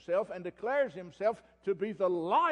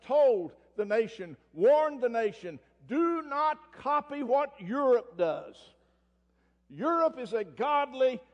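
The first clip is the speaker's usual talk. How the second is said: louder, higher, slow denouncing preacher; fervent preaching; 6 semitones higher, 6 dB louder, slower than usual. Acted. Preacher denouncing the leaders to his congregation, fiery. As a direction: thunder it from the pulpit.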